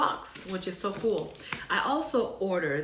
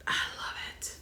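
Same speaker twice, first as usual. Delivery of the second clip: unvoiced soft whisper; whispered love it; 6 dB quieter than usual. Real, not acted. Vlogger whispering her love for the shirt, hushed. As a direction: whisper it like a secret.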